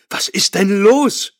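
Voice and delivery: a high, drawn-out scream of panic; cries out. A narrator's reading. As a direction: shriek it at him in alarm. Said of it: "schrie sie".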